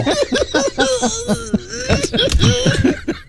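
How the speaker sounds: long, high-pitched cackle of laughter; sustained squealing laughter; high wheezing laugh. Real, not acted. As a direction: crack up helplessly, high and wheezy. Host cracks up after the score.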